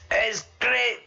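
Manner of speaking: high, cheerful mate saying it's great; lightly voiced gleeful Scottish friend; Scottish mate's answer. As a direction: chirp it like a drunk mate loving the fight.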